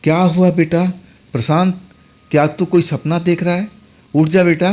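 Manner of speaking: worried mother. Retold or imitated. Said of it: "माँ की आवाज़ मेरे कानों में आने लगी".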